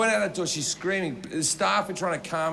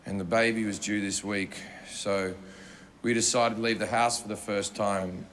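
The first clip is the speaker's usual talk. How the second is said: much lower and subdued, a quiet apology; a broken husband apologising to the room; grieving husband; 9 semitones lower, 3 dB quieter than usual. Retold or imitated. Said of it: "and he goes".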